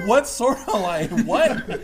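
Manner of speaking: loud, high, drawn-out mock alarm; freaked-out questioning. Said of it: "here's my second freak out".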